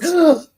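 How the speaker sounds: sudden shocked gasp; sharp gasp. Real, not acted. Gasps on seeing the neighbor's obituary, shocked.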